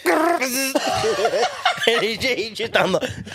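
high, laughing through the words. Real, not acted. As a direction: crack up mid-sentence. Laughing while describing scene.

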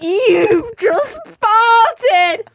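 high, slow shouted accusation; mock shout. Boyfriend catching her fart, high slow mock shout. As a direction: bellow it out like catching someone red-handed. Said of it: "he went".

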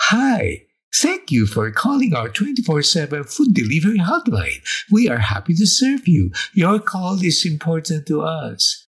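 chirpy automated hotline greeting; phone menu voice. Retold or imitated. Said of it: "the usual spiel is repeated".

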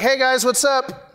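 high, upbeat greeting to a room; drawing attention. Acted. Walking in on friends, calling for their attention. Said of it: "how someone would draw attention to themselves".

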